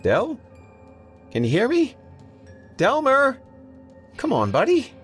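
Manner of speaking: insistent, high-pitched coaxing; urgent calling. A narrator's reading. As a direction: call out sharply to wake a friend. Calling to an unconscious friend.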